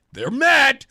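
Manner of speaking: excited. Real, not acted. Host gloating to cohost that listeners are mad, excited. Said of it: "I get excited".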